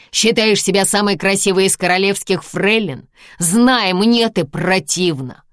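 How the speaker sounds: loud contemptuous tirade; angry. Acted. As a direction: rant with disgust. Husband berates wife.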